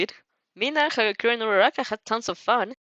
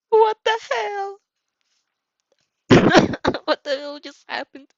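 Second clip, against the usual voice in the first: laughing disbelief; 10 semitones higher, 5 dB louder than usual. Real, not acted. Reader cracking up after reading.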